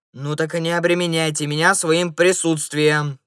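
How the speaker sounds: loud, annoyed snap; loud exasperated retort; irritated bark. A narrator's reading. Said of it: "раздражённо рявкнул первый".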